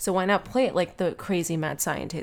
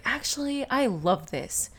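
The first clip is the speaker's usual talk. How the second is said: surprised; 7 semitones higher, slower than usual. Retold or imitated. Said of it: "I was like".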